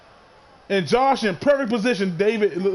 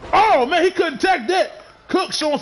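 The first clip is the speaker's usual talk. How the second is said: excited; 8 semitones higher than usual. Real, not acted. Commentator reacts to a missed move.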